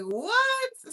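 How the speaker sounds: shocked what. Retold or imitated. Retelling her shock at being told to download WhatsApp, high and startled. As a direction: pop it up in disbelief. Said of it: "And I'm like".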